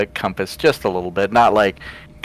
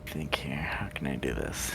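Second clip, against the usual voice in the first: breathy whisper to himself; 6 semitones lower, 17 dB quieter than usual. Real, not acted. Player puzzling over his next move, whispering. Player thinking aloud, unvoiced.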